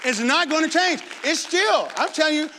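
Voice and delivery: rally the church to win souls; full-force preaching. Acted. Pastor urges his church to win souls, fervent preaching.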